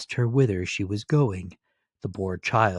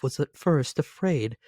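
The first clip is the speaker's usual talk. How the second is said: ordinary delivery, nothing marked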